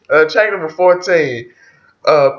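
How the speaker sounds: ordinary talk, nothing marked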